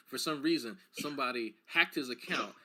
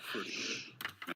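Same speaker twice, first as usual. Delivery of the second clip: breathy whisper; 6 semitones lower, 7 dB quieter, slower than usual. Shown as a demonstration.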